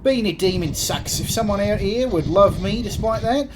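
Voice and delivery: postman Creed, wistful bluster. Acted. Demon postman on whether love survives, lilting Kiwi-ish Cockney. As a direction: philosophise like a lovelorn mate, pitch climbing.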